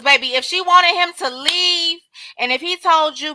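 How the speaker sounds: usual speaking voice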